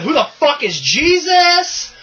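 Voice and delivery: high, drawn-out catchphrase; catchphrase delivery. Shown as a demonstration. Host offering better catchphrases to co-host, catchphrase delivery. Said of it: "saying it as a catchphrase".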